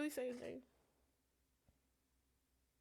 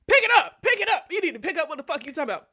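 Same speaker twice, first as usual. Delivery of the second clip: high, loud shout, then fast; furious demand; 5 semitones higher, 8 dB louder, faster than usual. Retold or imitated. Demanding the man explain himself, shouting. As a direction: yell it at him.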